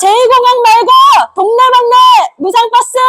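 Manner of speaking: high, loud protest chant; rally shout. Real, not acted. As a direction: call it out to the crowd.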